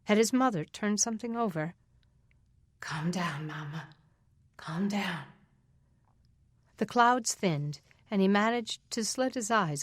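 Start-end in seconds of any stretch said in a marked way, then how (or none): 2.8-3.9 soft whisper, dismissive; hushing whisper. Acted. Father hushing crying mother. 4.6-5.2 quiet voiced repeat, no whisper; soft spoken hush. Father repeats to mother.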